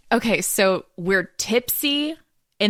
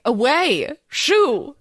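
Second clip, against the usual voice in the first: shooing hermit; 9 semitones higher, 5 dB louder, much slower than usual. Retold or imitated. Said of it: "Does Feasting Hay just go".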